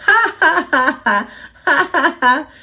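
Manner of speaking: high, loud mischievous cackle of a leprechaun; ha-ha taunting laugh; gloating laugh. Acted. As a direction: laugh at the failed trap. Leprechaun gloating over escaped trap.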